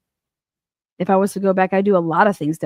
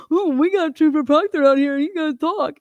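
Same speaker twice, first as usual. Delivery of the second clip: mocking quote; 7 semitones higher than usual. Host mimics interviewers' framing.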